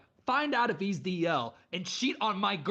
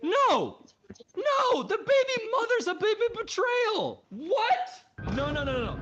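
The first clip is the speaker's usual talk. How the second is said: much higher, louder shocked disbelief; 14 semitones higher, 5 dB louder than usual. Real, not acted. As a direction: shriek in disbelief at the twist. Shocked reaction to the twist.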